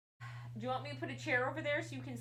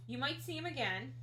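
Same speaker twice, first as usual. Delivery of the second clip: ordinary delivery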